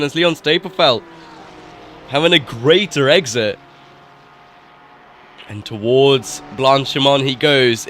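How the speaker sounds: excited sim racing commentary; live play-by-play. Real, not acted. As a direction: call the battle live, pushing energy up.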